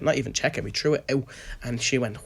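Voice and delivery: ordinary talk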